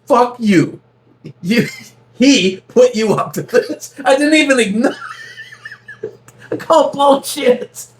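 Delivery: high, laughing retelling of his own reply; a laughing, mock-outraged retort; laughing disbelief. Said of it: "And I go".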